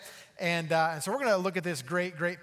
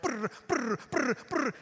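speed-reading noise; 7 semitones higher than usual. Pretend. Mimics rushing through chapters.

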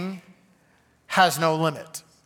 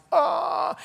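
high-pitched gasp of wonder; open-mouthed high "ah" at a sight; amazed little gasp; awed "ah"; 11 semitones higher than usual. Retold or imitated. Acts out awe at Christmas lights.

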